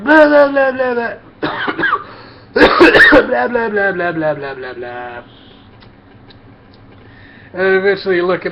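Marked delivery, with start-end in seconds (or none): 0.0-5.2 shrill, loud, mocking blah-blah of gossiping women; mocking loud chatter. Mocking coworkers' loud chatter at the break table. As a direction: parody their chatter as noise, high and loud. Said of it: "And so they're like".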